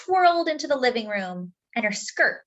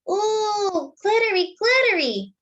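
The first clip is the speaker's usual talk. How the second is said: put-on high, cooing toddler voice; little brother marveling, pitched very high; delighted little boy; 13 semitones higher, 4 dB louder than usual. Toddler brother admires sister's skirt, high and cooing.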